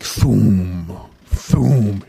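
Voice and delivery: low booming thud sound; dull thud. Retold or imitated. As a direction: boom out each heavy footstep. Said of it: "All he hears is, like".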